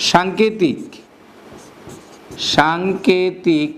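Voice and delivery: word by word with long pauses; slow dictation. Shown as a demonstration. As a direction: dictate it slowly, drawing out each word. Dictating a definition to students. (শ্রুতিলিপির মতো ধীরে, থেমে থেমে)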